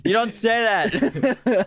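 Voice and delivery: high, slow mock scolding; drawn-out scandalized scold.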